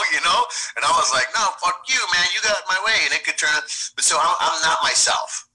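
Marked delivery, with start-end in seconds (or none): 1.4-3.0 higher, heated comeback to another driver; angry road rage retort. Acted. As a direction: snap back like a driver who got cut off. Acted road rage quarrel.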